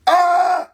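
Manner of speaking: short, high, loud "ah" scream; loud held scream, done for show; demonstrated scream. Acted. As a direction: let out a sudden high-pitched yell. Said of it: "I screamed again".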